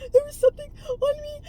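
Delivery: put-on high, sobbing child's cry. Scared child sobbing to her parents, crying high.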